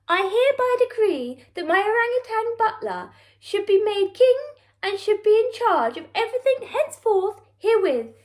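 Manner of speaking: pompous farewell announcement; grand royal decree. Acted. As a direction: read it like a royal edict. The Queen's farewell letter read.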